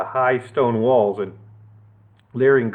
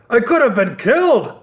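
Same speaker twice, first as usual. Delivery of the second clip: higher mock roar; 9 semitones higher, 3 dB louder than usual. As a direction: roar in outrage. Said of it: "Sir Horace roared".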